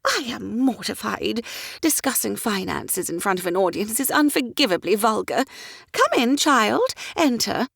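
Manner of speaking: high, excitable lady's voice; fussy society mother. A narrator's reading. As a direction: chatter like a flighty mother of the ton.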